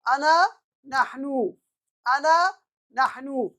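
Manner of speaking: अना stretched slowly with the stress on अना, then नहनु; drawn out, teacher's model; अना and नहनु said slowly for repetition; slow model reading. Shown as a demonstration. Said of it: "teacher models ana nahnu".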